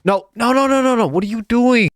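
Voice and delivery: high-pitched panicked pleading. Real, not acted. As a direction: squeal in alarm as it escapes. Cow wandering off, panic.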